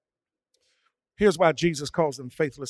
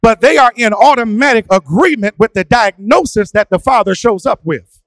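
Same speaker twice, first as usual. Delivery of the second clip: raised, ringing sermon delivery; preaching; 7 semitones higher, 11 dB louder than usual. Acted. A pastor preaching to his church, fervent and raised. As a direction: preach it to the congregation with rising force.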